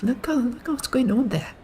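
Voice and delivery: put-on squeaky high girl voice; chatty girl. Voicing the chattering girl at the table, high and squeaky. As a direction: pipe up like an excited gossiping girl. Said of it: "She's like".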